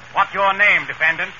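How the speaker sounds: ordinary delivery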